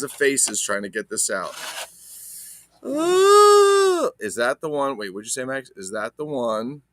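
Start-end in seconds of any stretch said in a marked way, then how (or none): 2.8-4.1 high, loud ooh of surprise; surprised ooh. Real, not acted. Collector seeing a piece, exclaiming in a high, loud ooh. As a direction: squeal it in delight.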